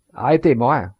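a loud command called across the hillside; shouted order. A narrator's reading. Gendarmes shouting after the fleeing poacher, loud call. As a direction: stop him with your voice from afar.

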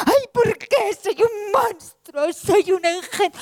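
high, slow crying complaint; tearful wail. Acted. Abandoned Truth lying by the road, slow high weeping. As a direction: drag out each word through tears.

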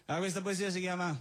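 ordinary talk

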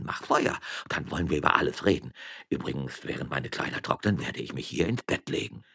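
put-on dismissive, wheedling old man; old rogue settling into a quiet murmur; imperious old magistrate.

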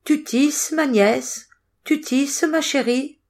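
high singsong questioning; Baba Yaga. A narrator's reading. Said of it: "Baba Yaga demanda encore une fois".

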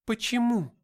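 high, loud demand; high-pitched female character voice; distressed woman's question. A narrator's reading. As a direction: cry out for an explanation. Gabriel questions the victor, stunned.

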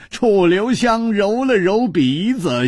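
ordinary delivery, nothing marked

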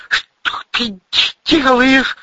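high, gasped question; aghast, high-pitched stammerer; breathless shock. A narrator's reading. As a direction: choke it out in alarm. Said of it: "задохнулся армеец".

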